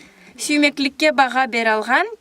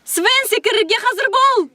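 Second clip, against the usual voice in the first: shouted call; 8 semitones higher than usual. Acted. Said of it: "Кричи".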